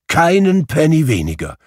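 high, emphatic declaration; emphatic insistence. Acted. Scrooge insisting on his generous donation, emphatically. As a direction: insist on it with gusto.

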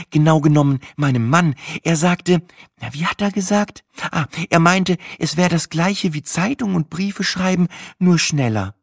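high-pitched, hesitant farmer's wife; chatty host searching for the quote; farmer's wife recalling. Acted.

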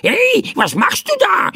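very high, startled squawk; squawked bird shout. A narrator's reading. Bird doused with flour by the boy, squawking. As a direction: cry out like an alarmed bird. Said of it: "rief der Vogel".

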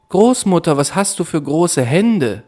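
rising alarm in her voice; girl getting scared of grandmother. Acted. Rotkäppchen grows alarmed.

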